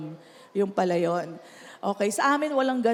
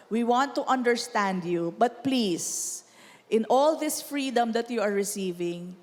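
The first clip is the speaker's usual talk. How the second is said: sincere plea; 6 semitones lower than usual. Real, not acted. Pleading with teens.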